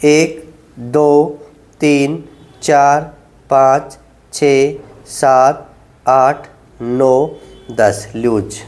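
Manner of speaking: low, drawn-out counting through the hold; slow held count. Shown as a demonstration. Physio to patients, counting out a slow ten-second hold. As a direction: count each second slowly while holding the stretch.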